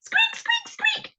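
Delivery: very high-pitched little squeaks; squeaking. A narrator's reading. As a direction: squeak like shoes on the move. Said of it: "squeaked his shoes".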